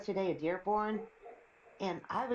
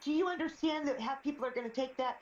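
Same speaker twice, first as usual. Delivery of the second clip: high-pitched retold scolding; mock yelling son; 7 semitones higher than usual. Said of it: "he yelled at me".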